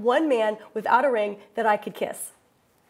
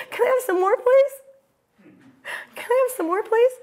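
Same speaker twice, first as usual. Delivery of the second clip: high, pleading, giggly plea for more; giddy begging; 9 semitones higher than usual. Retold or imitated. Spa client begging the masseuse for more milk, giddy and pleading. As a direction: beg like a greedy child, laughing.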